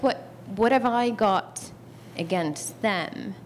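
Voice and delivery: usual speaking voice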